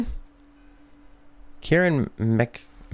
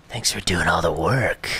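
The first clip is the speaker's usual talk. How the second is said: slow, sly villain whisper; 3 semitones lower, slower than usual. Retold or imitated. Enemy whispering thanks after stealing the treasure, slow and sly. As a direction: hiss it like a sneaky thief.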